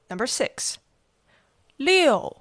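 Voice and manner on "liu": Liu falling sharply from high; fourth tone. Shown as a demonstration. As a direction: snap Liu straight down. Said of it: "fourth tone".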